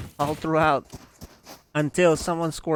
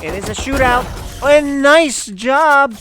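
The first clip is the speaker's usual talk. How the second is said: loud excited shootout call; esports caster; 10 semitones higher, 14 dB louder than usual. Acted. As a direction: hype the clutch play, near shouting. Shootout call in live cast.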